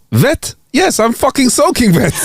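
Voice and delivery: put-on high, slow German accent on the punchline. German tourist answering a question, exaggerated German accent. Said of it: "Sorry about the German accent".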